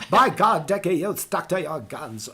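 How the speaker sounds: a loose, playful film-star impression; Katharine Hepburn. Voice actor improvising for his clients, playful film-star impression. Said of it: "sounding like Katharine Hepburn a little bit".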